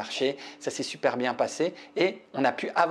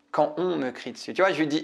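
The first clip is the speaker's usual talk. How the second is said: normal talking voice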